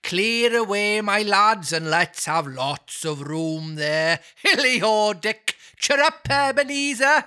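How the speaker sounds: high, hearty Welsh calls; a jolly master rousing his lads; jovial Welsh. Acted. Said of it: "cried old Fezziwig".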